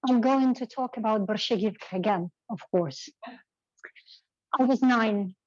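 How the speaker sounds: usual speaking voice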